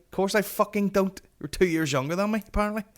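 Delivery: indignant retort. Retold or imitated. Retelling his indignant reply to her.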